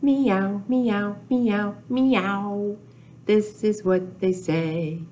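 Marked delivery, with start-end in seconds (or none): 0.0-2.8 meow chant. Pretend. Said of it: "Say it with me".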